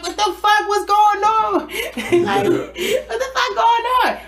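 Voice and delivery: high, loud, laughing mock panic; panicked homegirl. Homegirl woken at night by screaming, high laughing panic. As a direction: squeal it like a woken, panicked friend. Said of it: "She like".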